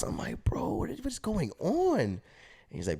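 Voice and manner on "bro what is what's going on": high-pitched bewildered question; baffled plea, voice jumping up; lost and confused. Retold or imitated. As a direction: imitate a totally lost kid. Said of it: "I'm just lost".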